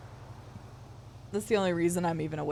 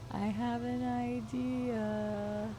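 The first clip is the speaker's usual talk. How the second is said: "I have an idea" drawn out very slowly, softer and higher; slow scheming tease; 5 semitones higher, 6 dB quieter, much slower than usual. Shown as a demonstration. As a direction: stretch every word like a sly plotter. Slow, soft repeat of line.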